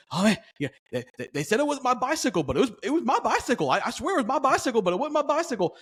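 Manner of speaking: high-pitched mock rambling. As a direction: imitate a man rambling in circles, pitched well up. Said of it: "he's rambling".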